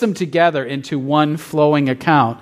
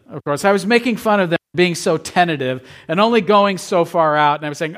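usual speaking voice

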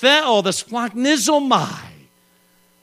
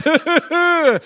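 higher, drawn-out imitation of a laugh; imitated laugh; 7 semitones higher than usual. Ride operator laughing at a rider's pleas, imitated laugh. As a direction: ride operator's mocking chuckle. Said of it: "So he laughed".

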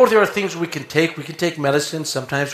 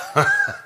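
high laugh. Real, not acted. Laughs at own joke.

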